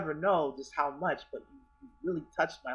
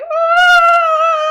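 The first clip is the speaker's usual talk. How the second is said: long, high, loud "oh"; drawn-out piercing squeal; re-enacted fan scream; 17 dB louder than usual. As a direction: scream it out in starstruck shock. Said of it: "And I was like".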